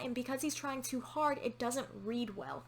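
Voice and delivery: usual speaking voice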